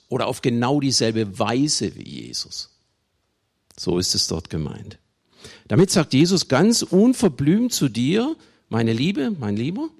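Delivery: usual speaking voice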